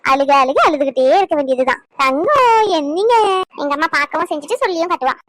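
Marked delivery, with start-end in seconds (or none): 2.0-3.1 slow, high, drawn-out coo; baby talk. Acted. Grandmother crooning to the baby, slow and high. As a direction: croon Thangam slowly up high.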